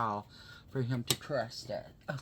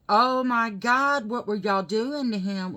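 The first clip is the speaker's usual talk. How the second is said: loud, higher, stretched-out dismay; re-enacted angry demand; retold freaked-out outrage; 7 semitones higher, 12 dB louder than usual. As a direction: relive confronting the staff, upset. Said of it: "I was freaking out and pissed off".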